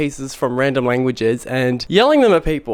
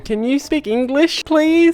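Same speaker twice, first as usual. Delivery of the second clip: higher pleading ask; 11 semitones higher, slower than usual. Retold or imitated. Tourist stuck in Mandarin, pleading higher. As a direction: beg sheepishly to switch to English. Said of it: "roughly translates to".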